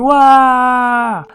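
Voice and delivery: long, high, loud mock wail; hammed-up drawn-out crying noise. Cook wails over being bullied, hammed-up cry. Character wails about bullying.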